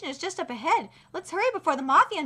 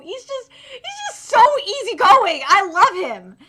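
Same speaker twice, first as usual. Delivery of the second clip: louder adoring gush; 4 semitones higher, 11 dB louder than usual. Real, not acted. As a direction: swoon over him out loud. Gushing over Rampo.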